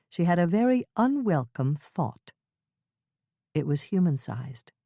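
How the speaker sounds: ordinary delivery, nothing marked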